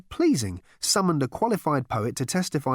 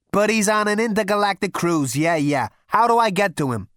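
loud, cocky and impatient; brash Zaphod; 8 semitones higher, 6 dB louder than usual. Acted. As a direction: throw your weight around. Zaphod cuts in.

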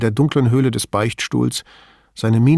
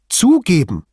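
higher and louder, indignant scorn; more agitated; 6 semitones higher, 4 dB louder than usual. A narrator's reading. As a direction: spit out the word Zugeben with contempt. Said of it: "fuhr noch aufgebrachter fort".

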